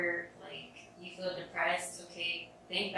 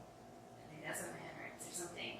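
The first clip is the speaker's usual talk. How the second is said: whispered aside; 11 dB quieter than usual. Real, not acted. Talking to his listener about feeling bad, a whispered aside. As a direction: drop to a whisper for the side note. Aside while listing self-checks.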